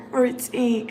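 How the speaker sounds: laughing through the word; giggling as it comes out. Real, not acted. Reading about routine, laughing through the word.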